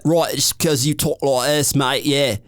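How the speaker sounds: slow, lazy put-on English accent; caricatured English accent, cocky; mock English accent. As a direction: play a bluffing English coach, English accent. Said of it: "listening to people that".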